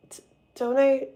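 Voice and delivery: stumbles on the first word; d-, don't, stuttered start; stuttering. Real, not acted. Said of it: "I'm stuttering".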